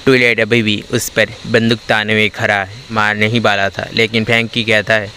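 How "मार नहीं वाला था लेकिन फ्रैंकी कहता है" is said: ordinary delivery, nothing marked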